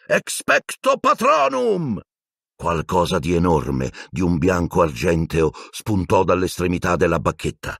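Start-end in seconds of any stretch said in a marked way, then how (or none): 0.1-2.0 high, slow drawn-out incantation; roared spell. Acted. Harry casting at the dementors, slow high roar. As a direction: bellow the spell. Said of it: "ruggì".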